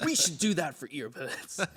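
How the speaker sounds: laid-back surfer dude; lightly voiced surfer bro pitching an idea. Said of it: "you know how I sound like a surfer dude".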